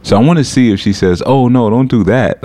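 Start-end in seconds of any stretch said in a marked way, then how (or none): 1.2-2.4 mock concern. Imagines scammer's mock-concerned reply.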